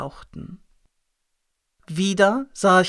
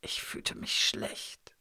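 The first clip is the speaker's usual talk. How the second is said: whispering; 13 dB quieter, much slower than usual. A narrator's reading. Narrator faint after collapse.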